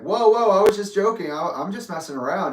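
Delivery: retold startled backing-off, starting high and loud; alarmed "whoa", easing into placating; taken aback. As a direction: protest it was a joke, settling down. Retold backing off from angry father.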